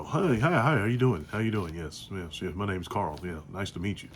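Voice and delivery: polite formal voice. Retold or imitated. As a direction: greet a local politician softly and properly. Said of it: "conversation with a dignitary".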